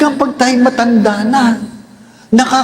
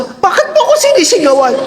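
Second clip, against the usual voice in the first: put-on offended old person; 9 semitones higher, faster than usual. Old person complaining of shouting.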